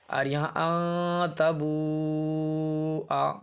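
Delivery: drawn-out recitation, alif left silent; slow tajweed recitation; Quranic recitation. Shown as a demonstration. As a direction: recite it slowly with the alif unspoken. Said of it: "अलिफ को यहाँ काट दिया".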